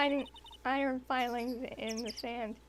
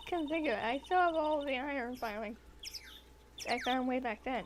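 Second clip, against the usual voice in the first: normal talking voice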